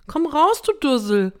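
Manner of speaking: loud, high summons; high scolding call. A narrator's reading. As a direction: shout for someone in hiding to come out. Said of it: "rief sie".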